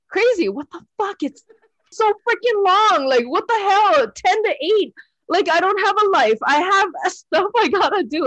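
high, whiny retold rant; exasperated complaint. Complaining to her mentor Crazy on the phone, high and whiny. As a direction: whine at him like the day is ruined.

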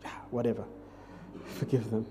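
dismissive mutter. Retold or imitated. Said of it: "I'm like".

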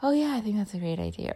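quick phrase that peters out; trailing off. Shown as a demonstration. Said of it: "some people also trail off when they're speaking".

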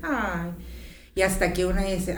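ordinary delivery

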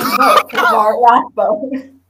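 a touch of slow, loud announcer; Cinema Sins voice. Retold or imitated. Podcaster imitating a campaign ad for the co-host, lightly voiced announcer. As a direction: lightly imitate a slogan-reading video narrator. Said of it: "like the Cinema Sins voice".

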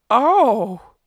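sudden loud "Oh" of wonder; Molly exclaims as the legend walks past; startled gasp. Acted. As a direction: gasp it out, caught off guard. Molly sees the figures pass.

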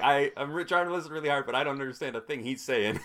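imitating mom's puzzled complaint; a touch of a bewildered mother. Mom voiced, straining to follow.

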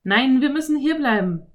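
put-on boy hurriedly refusing; hasty boy. A narrator's reading. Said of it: "sagte Philipp hastig". (hastiger Junge)

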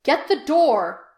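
loud, slow shout. A narrator's reading. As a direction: bellow it across the house. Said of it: "Pike shouted at Number One".

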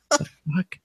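high, soft, baffled reaction; retold disbelief. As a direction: pitch it right up in quiet disbelief. His retold reaction to the quote.

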